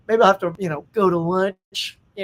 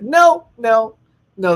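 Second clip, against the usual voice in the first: loud, higher, firm refusal; emphatic refusal; 3 semitones higher, 8 dB louder, much slower than usual. Real, not acted. Streamer refusing to visit Shutter Island, emphatic. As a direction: shut it down loudly.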